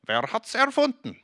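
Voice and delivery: high put-on voice of the Swiss man from the ad; Ricola ad slogan. Preacher quoting the Ricola ad to the congregation, high and playful. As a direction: quote the ad jingle in a character voice. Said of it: "Kennt ihr diesen Slogan?".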